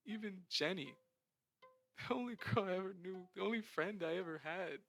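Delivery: crying. Acted. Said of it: "through his crying and sobs".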